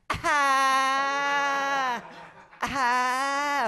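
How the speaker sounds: high, sustained "Ah!" held out long; long mock scream. Woman at the train doors after her insult, imitated scream. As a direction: hold one high screeched note. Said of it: "I'll make that sound".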